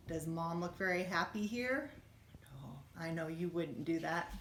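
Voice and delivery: plain reading voice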